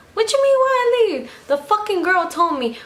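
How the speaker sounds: high, loud outraged retort; indignant shout. Retold or imitated. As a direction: hurl the question back at him. Shouting back at Jose.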